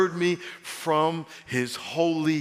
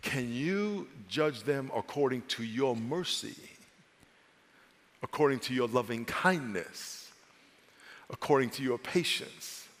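gentle prayer; 2 semitones lower, 4 dB quieter than usual. Shown as a demonstration. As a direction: plead gently for mercy. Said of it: "what you want to say is".

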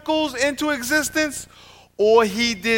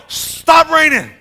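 raised, louder, slow mock yell at the sky; 7 semitones higher, 7 dB louder, slower than usual. Preacher daring class to yell at rain, slow mock yell. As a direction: bark it at the rain with a laugh. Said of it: "go outside and yell".

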